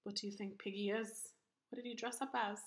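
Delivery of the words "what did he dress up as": ordinary delivery, nothing marked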